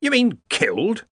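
high, slow, stunned question; jaw-dropped, barely believing it; shocked disbelief. Acted. Arch-Chancellor stunned by news of a killing, high shocked disbelief. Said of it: "Ridcully's mouth dropped open".

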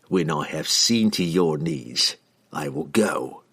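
calm killer stating a fact; cold assassin. A narrator's reading. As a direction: assassin telling the mage coldly. Entreri speaks.

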